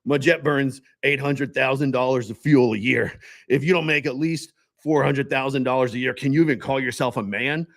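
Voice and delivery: a cocky, boastful self-help guru; Grant Cardone impression. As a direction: brag like a hyped-up millionaire salesman. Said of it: "now I gotta get my best Grant Cardone voice".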